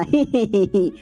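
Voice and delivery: short awkward laugh; a quick nervous laugh to cover up; awkward chuckle. Acted. Hero laughs off the witch, awkward chuckle.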